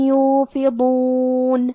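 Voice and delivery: یوفضون chanted slowly, heavy ḍad, tanween merged into ya with a hum; slow Quranic recitation of یوفضون; idgham with ghunna. Shown as a demonstration. Teacher demonstrates idgham to students, slow chant. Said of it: "غنہ اور تشدید کے ساتھ یہ حکم کو ادا کریں".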